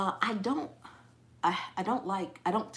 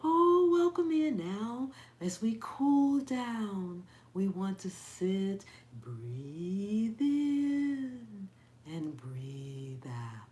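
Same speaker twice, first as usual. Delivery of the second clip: mock soothing yoga teacher; much slower than usual. Imitating her yoga teacher at cool-down, syrupy slow calm. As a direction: glide and draw out each phrase, parodying calm. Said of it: "had to go in there and listen to her".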